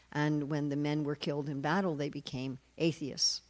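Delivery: usual speaking voice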